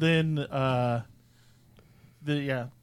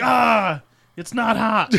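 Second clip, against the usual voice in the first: laughing Hulk impression; 8 semitones higher, slower than usual. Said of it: "He was like".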